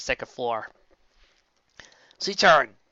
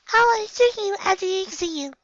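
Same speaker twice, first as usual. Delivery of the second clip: much higher, light and friendly; sweet pony voice. Acted. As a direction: greet her kindly. Twilight speaking warmly.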